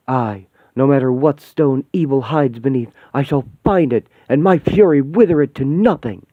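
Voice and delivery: loud, booming righteous vow; wrestler-style fury. Acted. To her friend, vowing to destroy evil, booming fury. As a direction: swear vengeance like a pro wrestler. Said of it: "you're talking like fucking wrestlers".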